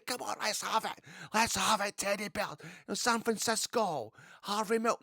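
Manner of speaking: high, coaxing call to join in; high, eager chant egging on the stands; urging the crowd. Acted. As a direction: come on, rouse them to sing.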